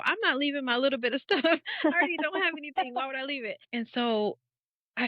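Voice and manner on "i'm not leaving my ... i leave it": defiant self-talk. Retold or imitated. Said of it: "And I'm thinking".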